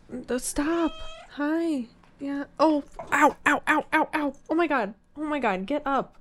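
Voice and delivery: high, soft yelps of pain; pained high squeal. Real, not acted. Speaker to cat clawing her, pained and high. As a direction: squeak out small ows, quietly pleading.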